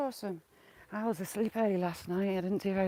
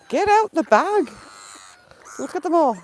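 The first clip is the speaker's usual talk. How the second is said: high and loud, shouting at the greedy birds; excited shout; 7 semitones higher, 8 dB louder than usual. Real, not acted. To the ducks mobbing her bag, a high, loud shout. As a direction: yell it as the flock swarms the bag.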